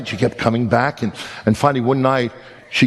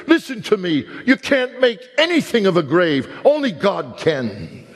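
raised pulpit exhortation that slows at the end; preaching burst; 4 semitones higher than usual. Acted. Pastor exhorting the congregation mid-story, fervent.

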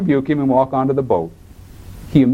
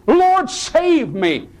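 high, drawn-out plea for rescue; crying out; much slower than usual. Acted. Sinking Peter calls to the Lord for rescue, crying out. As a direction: cry out to be saved, stretching each word. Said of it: "he cried out".

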